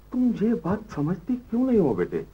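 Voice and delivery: high, impatient pleading; exasperated. Acted. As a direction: plead with your child, losing patience. Father loses patience with daughter. (झुंझलाहट भरी ऊँची आवाज़ में मिन्नत)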